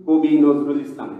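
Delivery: কবি said as কোবি, first vowel rounded o; correct reading of কবি. Shown as a demonstration. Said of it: "আমরা উচ্চারণ করি". (শিক্ষকের মতো কবি শব্দটি কোবি উচ্চারণ)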